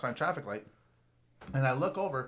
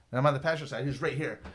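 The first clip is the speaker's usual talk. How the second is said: ordinary talk, nothing marked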